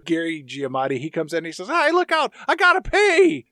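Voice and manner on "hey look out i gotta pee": high, loud, acted holler; comic high-pitched urgency; urgent shout. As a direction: burst in yelling in a hurry. Gary bursts in, acted.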